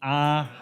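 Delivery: "Ah." with the mouth wide open; exaggerated doctor-style "Ah."; big ah. Shown as a demonstration. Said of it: "make a big ah".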